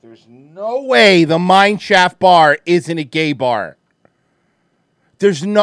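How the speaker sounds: slow, loud, disbelieving; loud incredulous. Real, not acted. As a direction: drag it out in total disbelief.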